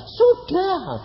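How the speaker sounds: high, drawn-out smug retort; mock-smug high retort; smug reply. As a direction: mimic the cocky answer, stretched. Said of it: "wong dikasih tahu kok bilang".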